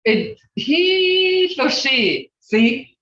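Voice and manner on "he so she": "he" held long, high and loud, then "so she"; emphasis on he. Shown as a demonstration. Teacher answering the student, he stretched high and loud. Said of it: "it doesn't matter really".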